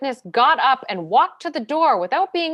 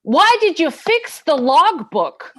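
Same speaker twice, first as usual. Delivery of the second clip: loud, higher and slow, each word hammered; angry demand; 6 semitones higher, 7 dB louder, slower than usual. Acted. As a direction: drive the question home. Lawyer keeps pressing despite objection.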